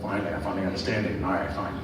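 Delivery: low, soft and quick, half to himself; a mumbled imitation of a relieved listener; mock relief. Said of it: "Mister Zaragoza is like".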